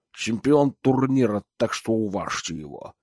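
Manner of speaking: gurgle the words out like a pudgy guard; gurgling voice. A narrator's reading. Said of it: "произнёс он булькающим голосом".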